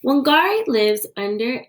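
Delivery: ordinary delivery, nothing marked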